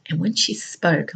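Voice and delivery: normal talking voice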